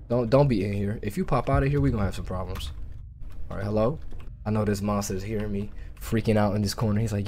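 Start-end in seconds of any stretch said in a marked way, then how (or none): none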